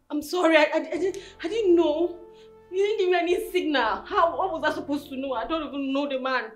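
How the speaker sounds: high, wailing self-defence; distressed pleading. Acted. Girlfriend defending herself to her angry man, distressed pleading. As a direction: plead innocence in a high, rushed voice. Girlfriend protests she didn't know.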